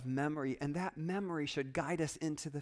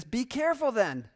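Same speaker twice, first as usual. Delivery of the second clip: loud, raised warning; urgent warning; 8 semitones higher, 7 dB louder than usual. Acted. Preacher voices the writer warning his readers, urgent. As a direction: call it out as a caution.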